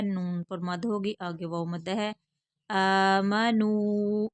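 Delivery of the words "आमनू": आमनू very slow, long आ and long नू; drawn-out vowels. Shown as a demonstration. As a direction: stretch both ends of आमनू. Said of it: "Hamza को खींच कर पढ़ेंगे". (आमनू, खींच कर)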